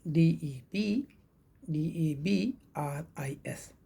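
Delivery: very slow, letter by letter spelling. Shown as a demonstration. Teacher spelling the word for students, letter by letter. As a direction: spell each letter out slowly and clearly. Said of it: "आप सोचेंगे कि एस लगा है".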